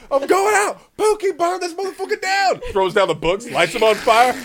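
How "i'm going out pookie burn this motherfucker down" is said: high, loud mock battle cry; hammed-up high-pitched rebel shout; rampaging kid. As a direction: yell it like a kid gone rogue. Imagined kid's rampage.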